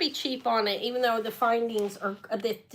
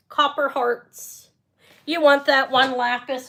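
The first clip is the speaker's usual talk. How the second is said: ordinary talk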